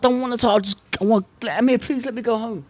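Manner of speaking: high, whining plea to go home; worn-out fighter pleading to leave. Retold or imitated. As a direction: beg in a high, whiny voice. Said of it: "I said".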